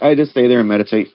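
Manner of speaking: ordinary talk, nothing marked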